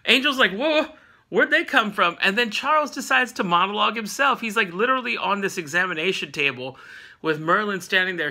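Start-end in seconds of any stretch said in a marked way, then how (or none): 0.5-2.2 higher startled Angel; mock surprise. Angel sees the X-Men appear, mock startled. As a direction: play the startled onlooker, pitched up.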